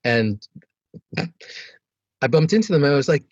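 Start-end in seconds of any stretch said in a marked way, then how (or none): none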